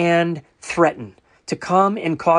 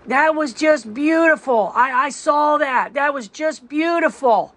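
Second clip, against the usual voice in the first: higher, slow, breathy-free gushing praise in a put-on neighbor voice; 9 semitones higher, slower than usual. Neighbor interrupting his prayer to gush, high slow imitation. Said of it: "she goes".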